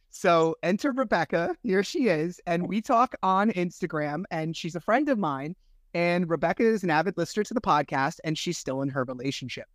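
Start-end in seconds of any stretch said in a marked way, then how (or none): none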